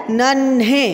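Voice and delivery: नन्हे with a breathy heavy ह sound; नन्हे, the heavy नह sound clearly voiced; heavy aspirated nh. Shown as a demonstration. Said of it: "भारी आवाज़ वाला".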